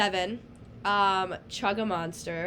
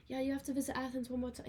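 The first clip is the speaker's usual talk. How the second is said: quick, softer imitation of a British accent; mock British accent; 4 semitones higher, 8 dB quieter, faster than usual. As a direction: toss off a British accent, a bit rushed. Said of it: "I need the British accent for it".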